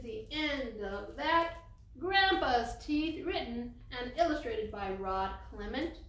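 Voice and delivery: put-on lively storybook read for little kids; story reading. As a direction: read it aloud like to a class of children. Reading picture book to children.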